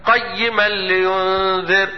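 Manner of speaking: slow chanted tajwid recitation; Quran recitation. Shown as a demonstration. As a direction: chant the verse slowly, melodic.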